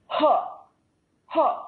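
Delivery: sharp pushed-out huh grunts; forceful breath grunt. Shown as a demonstration. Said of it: "So you might go".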